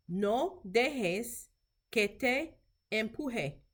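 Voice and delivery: measured phrases with a pause midway; slow language model. Shown as a demonstration. Teacher modelling Spanish for clinicians, slow and careful. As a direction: say it slowly for learners to repeat.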